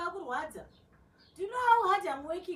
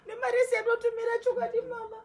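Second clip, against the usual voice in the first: angry; 6 semitones higher, 6 dB louder than usual. Acted. Daughter rages over money sent.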